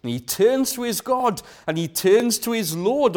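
ordinary talk, nothing marked

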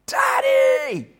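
put-on high-pitched shriek of a small boy calling his dad; piercing kid's yell for his father; child screaming Daddy. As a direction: scream it at the top of your lungs like a little kid. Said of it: "He screams ... at the top of his voice".

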